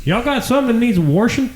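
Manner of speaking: high-pitched comic greeting; neighborly hail. Acted. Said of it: "walk around the neighborhood and be like".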